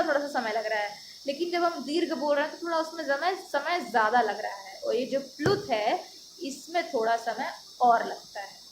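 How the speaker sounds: normal talking voice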